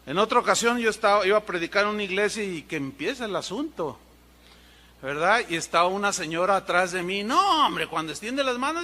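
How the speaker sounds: ordinary talk, nothing marked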